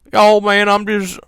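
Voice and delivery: high, loud mumbly-rambler impression; exaggerated cartoon-character voice; Boomhauer. Shown as a demonstration. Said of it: "fucking Boomhauer talking".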